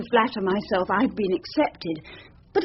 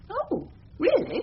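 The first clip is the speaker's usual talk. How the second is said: pitched up high and slow; surprised; 9 semitones higher, 4 dB quieter, slower than usual. Acted. Young barrister startled to hear of a new member. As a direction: rise up in startled interest.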